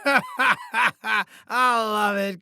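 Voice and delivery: drawn-out gloating laughter; laughing at own joke. Acted. Boss tickled by own voicemail prank, laughing. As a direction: laugh through it, savouring the gag.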